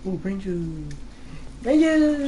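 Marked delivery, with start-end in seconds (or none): 1.6-2.3 high, silly outburst; playful exclamation. Real, not acted. Gamer teasing co-player over the gore, playful high exclamation. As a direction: pipe it up high, drawn out.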